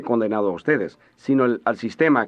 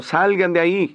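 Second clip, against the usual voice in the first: mock shout in a higher voice; interpreted warning shout; 6 semitones higher than usual. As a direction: echo a preacher's urgent cry. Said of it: "Les estaría gritando".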